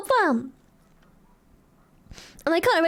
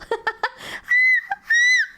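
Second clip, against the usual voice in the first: seagull screech. Shown as a demonstration. Streamer demos the seagull voice to chat. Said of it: "The seagull".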